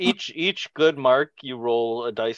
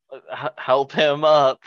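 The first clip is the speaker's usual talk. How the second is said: ordinary talk, nothing marked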